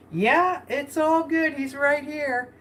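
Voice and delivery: a light, easy imitation of Lisa answering; Lisa's reply. She quotes Lisa's reply.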